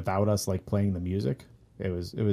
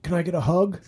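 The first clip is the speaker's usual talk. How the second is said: high-pitched imitation of an eager fan; pleading, pitched-up mock fan; eager request; 8 semitones higher than usual. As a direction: act out a hopeful plea to a star. Friend's eager request, acted out.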